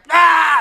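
high-pitched comic yelp of a scream; imitated scream. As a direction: let out a short, loud mock scream. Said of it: "Is there, like, a, like".

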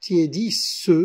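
"tiédissent" ending in a clear "-seu"; "tiédissent" with the last E voiced; counted final E. Shown as a demonstration. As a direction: say "tiédissent" as tié-dis-seu. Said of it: "la consonne S après ce E".

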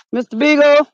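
loud high call for the dog. Acted. Searching outdoors for the dog, loud call. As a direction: shout the dog's name into the night.

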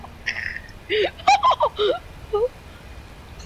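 laughing fit, high squeals; high cackling giggles; high-pitched laughter. Real, not acted. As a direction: burst into high, helpless laughter. Burst of laughter at game.

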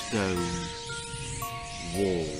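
ordinary delivery, nothing marked

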